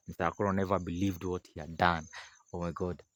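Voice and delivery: plain reading voice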